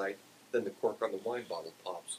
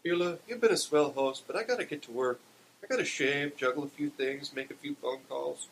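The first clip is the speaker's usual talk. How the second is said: lightly voiced, higher-pitched excuse; Barney's character voice; 6 semitones higher, 3 dB louder than usual. A narrator's reading. Barney excusing himself to Beulah, raised voice. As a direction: make a polite getaway, pitched a little up.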